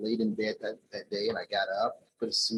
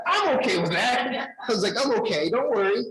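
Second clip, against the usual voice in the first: retold emphatic insistence; 13 semitones higher, 8 dB louder than usual. Employee insisting to HR lady he accepts it, retold emphatic and loud. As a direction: hammer it home, much higher and louder.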